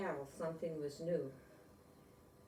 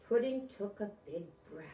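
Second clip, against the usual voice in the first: ordinary delivery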